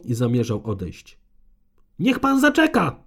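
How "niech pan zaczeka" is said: much higher, loud yell; yelling after him. A narrator's reading. Conservator yells after the departing Gabriel, loud and high. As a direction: call him back as he walks away. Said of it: "wrzasnęła za nim".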